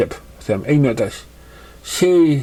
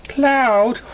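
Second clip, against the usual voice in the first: calling his name high across a distance; high call after a friend; 13 semitones higher than usual. Acted. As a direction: shout to stop him leaving. She calls after him.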